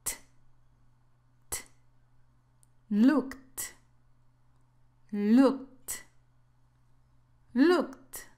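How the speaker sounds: the ed of Looked as a bare T sound, then the word; model pronunciation. Shown as a demonstration. Teacher showing learners how the ed ending sounds, careful demonstration. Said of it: "aquí se pronuncia así".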